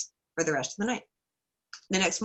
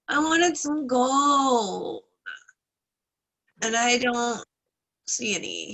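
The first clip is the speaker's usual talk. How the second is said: drawn-out, slightly whiny trickster; slow plaintive trickster; 5 semitones higher, slower than usual. Retold or imitated. Trickster explaining himself to the bear, slow and plaintive. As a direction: stretch each word like a child caught out.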